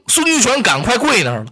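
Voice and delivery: ordinary delivery, nothing marked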